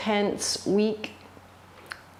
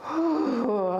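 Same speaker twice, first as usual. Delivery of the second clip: audible whooshing exhale, higher pitched; shake-off breath; 5 semitones higher than usual. Shown as a demonstration. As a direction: blow the breath out with a whew. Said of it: "few of those shake off, uh, breaths".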